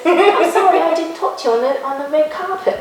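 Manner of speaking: high-pitched celebrity impression; David Beckham. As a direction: lift the voice high for his apology. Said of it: "No, that's the accent".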